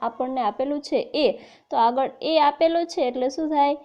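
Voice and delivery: ordinary talk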